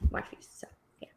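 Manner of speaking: whisper. Real, not acted. Trailing aside about views.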